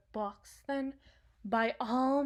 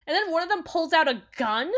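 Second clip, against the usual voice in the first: loud, high, fast disbelief; heated, rushing complaint; indignant rant; 7 semitones higher, 9 dB louder, faster than usual. Acted. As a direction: build into outraged incredulity. Ranting about the botched heist.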